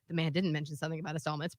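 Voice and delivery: low, soft, rushed correction; quick aside. Real, not acted. Speaker correcting the caller's claim to listeners, quick aside. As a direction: drop down and slip it in fast.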